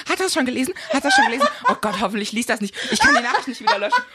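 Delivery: frantic laughing fret over a sent text; panicky. Retold or imitated. Drunk self panicking over message.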